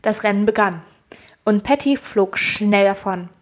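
ordinary talk, nothing marked

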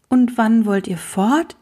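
ordinary delivery, nothing marked

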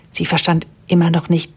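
ordinary delivery

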